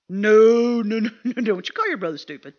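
long loud first no, then rapid scolding; Mama's scolding. Retold or imitated. Mama scolding her child over an insult, imitated reprimand. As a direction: lightly act out a mother cutting in. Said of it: "you know what Mama say?".

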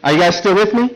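normal talking voice

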